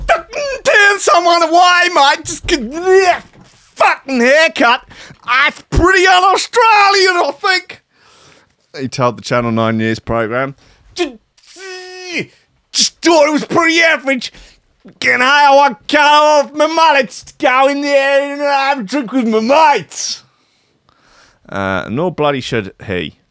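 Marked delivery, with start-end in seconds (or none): 0.1-7.8 high, shouted Australian outrage; over-the-top indignant Aussie yell; exaggerated Aussie bloke. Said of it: "how do we think he, he speaks?". 10.9-20.2 high, strained Australian shout; hammed-up Aussie holler; exaggerated Aussie bloke. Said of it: "how do we think he, he speaks?".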